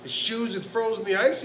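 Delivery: usual speaking voice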